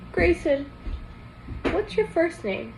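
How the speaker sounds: put-on high curious boy asking; eager question. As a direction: ask him like a curious kid.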